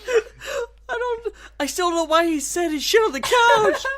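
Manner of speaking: high, tearful laughing voice; helpless laughter. Real, not acted. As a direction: barely get it out while crying with laughter. Breaking up laughing over the story.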